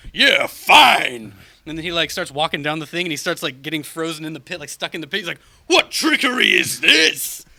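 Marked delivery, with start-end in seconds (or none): 0.1-1.3 Titan voice. Acted. Thanos answers the Fates. 5.7-7.5 slow, louder, higher hammed-up god outrage; Mad Titan shout. Thanos trapped in the pit.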